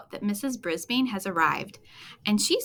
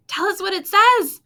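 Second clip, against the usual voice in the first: much higher and louder call; 11 semitones higher, 10 dB louder than usual. Acted. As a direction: shout it across the room. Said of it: "I call out".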